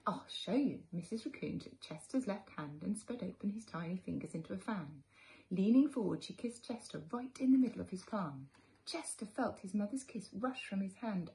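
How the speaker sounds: put-on gentle read-aloud for little children; storybook narration. A narrator's reading. Teacher reading a picture book to children, gently. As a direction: tell it like a bedtime story to kids.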